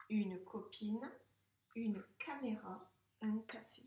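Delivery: "une copine, une caméra" very slow, the second one unvoiced and whispered; slow reread. Shown as a demonstration. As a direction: read it slowly, hushing the second word. Teacher rereads words slowly.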